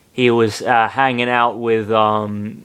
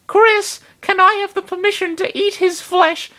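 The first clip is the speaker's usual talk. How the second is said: much higher, eerie polite request; creepy Lucario. Acted. Lucario asking Chris for flesh, much higher and eerie. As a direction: ask sweetly for flesh.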